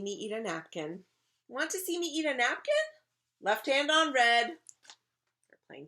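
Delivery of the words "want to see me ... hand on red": put-on high, loud kid voice; excited child showing off; eager attention-seeking. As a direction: call out to get noticed. Child keeps begging for attention.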